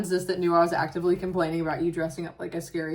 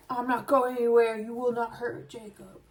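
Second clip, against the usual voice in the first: higher, put-on brave declaration; mock heroic defiance; 4 semitones higher than usual. Partner imagines defending Jacob from a clown, mock defiant.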